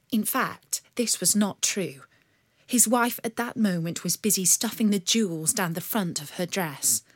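ordinary delivery

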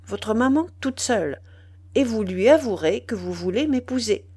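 plain reading voice

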